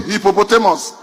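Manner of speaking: usual speaking voice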